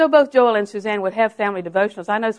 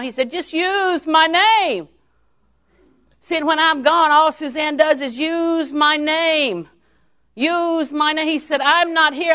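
high, ringing proclamation with drawn-out endings; full-force preaching; 9 semitones higher, 6 dB louder, slower than usual. Real, not acted. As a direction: cry out the refrain to the congregation.

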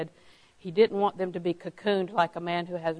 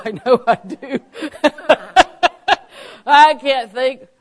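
real laughter through the words; laughing; 4 semitones higher, 10 dB louder, slower than usual. Real, not acted. Preacher laughing at own forgetfulness, laughing through words.

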